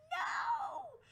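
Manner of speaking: very high, loud shrieked no; panicked shout. Retold or imitated. Friend stopping her from attacking, in a loud high scream. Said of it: "she's like".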